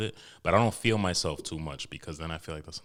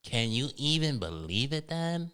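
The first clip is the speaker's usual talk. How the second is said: imitating daughter; 8 semitones higher than usual. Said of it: "That's what she does every time now".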